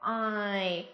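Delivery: "I" with a wide-open first sound gliding slowly into a short ee; drawn-out glide. Shown as a demonstration. Teacher models the vowel for students, drawn-out glide on "I". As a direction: open the mouth wide on "I", then slide it closed. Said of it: "âm O này sẽ được mở rộng miệng".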